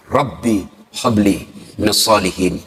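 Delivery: preacher slowly reciting a Quranic prayer; prayer recitation. Acted. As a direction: intone it like a quiet prayer. Reciting Ibrahim's prayer in Arabic.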